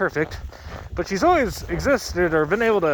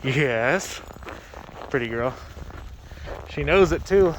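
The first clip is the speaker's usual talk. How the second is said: usual speaking voice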